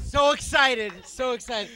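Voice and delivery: high, excited movie-star impression; Tom Cruise. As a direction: gush loudly like a hyped-up star. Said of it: "are you really doing Tom Cruise".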